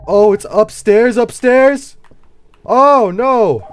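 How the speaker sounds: high, loud frightened cry; panicking about having to go upstairs; scared yelp. Real, not acted. Gamer yelping in fear at the horror game.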